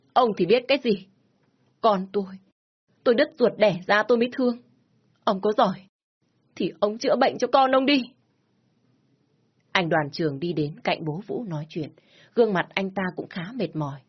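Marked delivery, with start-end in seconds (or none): 0.1-8.0 higher and louder, fast bitter comeback; angry retort. Acted. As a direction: fire it back at him, hurt and furious. Mother hits back at father.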